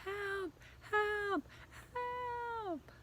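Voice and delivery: high desperate calls for help; terrified cries. Acted. Trapped boy keeps screaming for help. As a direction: call to someone outside. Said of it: "I screamed".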